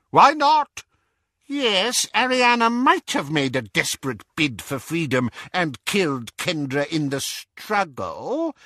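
high, sharp start easing into musing; thoughtful. A narrator's reading. Auntie Muriel speculating on Kendra's death, thoughtful. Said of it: "said Auntie Muriel thoughtfully".

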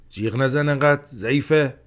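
فریاد خشمگین؛ داد بلند و تند سر زن؛ با خشم فریاد بزن؛ فریاد خشمگین حاجی سر زن. A narrator's reading. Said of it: "حاجی به خشم فریاد زد".

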